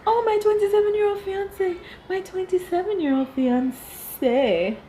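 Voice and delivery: mock doting bride voice; mocking Rebecca. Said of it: "Rebecca's like".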